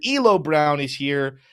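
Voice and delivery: ordinary talk, nothing marked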